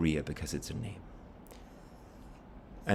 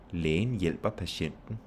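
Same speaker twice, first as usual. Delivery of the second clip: slow, with "Lægen", "hjælper" and "patienten" each stressed; stress on each word; slower than usual. Shown as a demonstration. Teacher showing noun stress, slowly weighting "Lægen", "hjælper", "patienten". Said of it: "you have to stress each of these words".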